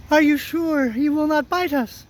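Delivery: high, small squeaky question; timid mouse. Acted. Mouse queen afraid of the dog, timid high voice. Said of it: "in a timid voice".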